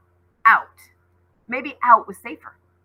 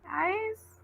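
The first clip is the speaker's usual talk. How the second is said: frightened call; 11 semitones higher, 7 dB quieter than usual. Acted. Lost girl calling for friends.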